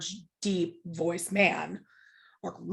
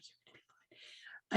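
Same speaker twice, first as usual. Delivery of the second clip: whispering; 20 dB quieter than usual. Retold or imitated. Wife reassuring before the flight.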